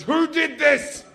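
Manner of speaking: high, slow mock-angry mum voice; hammed-up shrieking mum; furious mum. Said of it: "She goes".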